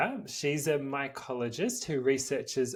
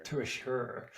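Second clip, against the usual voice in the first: put-on Irish accent; 6 dB quieter than usual. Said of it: "As my Irish heritage suggests".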